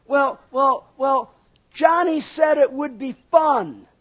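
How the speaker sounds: put-on a kid scrambling to pass the blame; child making excuses. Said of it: "children do this a lot".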